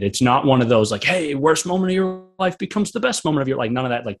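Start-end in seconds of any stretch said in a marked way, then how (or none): 1.0-3.6 high, singsong motivational cliché; mock a cheesy inspirational slogan in a raised put-on voice; cheesy mock pep talk. Speaker dismissing feel-good clichés about worst moments, cheesy and mocking. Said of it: "cheesy stuff".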